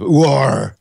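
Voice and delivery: loud pirate roar; pirate accent. Acted. Demonstrating a pirate accent to co-hosts, loud pirate roar. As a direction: growl it out like a stage pirate. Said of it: "if you're doing a pirate accent".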